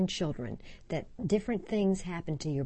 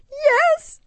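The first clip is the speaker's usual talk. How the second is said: put-on very high, loud tearful little-girl wail; child wailing; 14 dB louder than usual. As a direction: wail the answer like a terrified child. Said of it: "[name] wailed".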